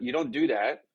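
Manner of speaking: ordinary delivery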